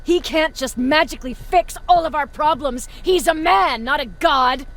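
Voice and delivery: loud, heated protest; angry outburst. Acted. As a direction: push back hard at your superior. Commander angrily arguing with superior.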